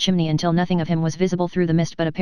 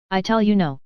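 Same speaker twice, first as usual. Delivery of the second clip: ordinary delivery, nothing marked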